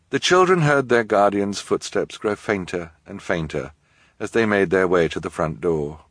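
ordinary delivery, nothing marked

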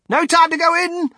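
very high, hurried shout. A narrator's reading. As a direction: holler it while rushing past. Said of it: "shouted Mister Wonka".

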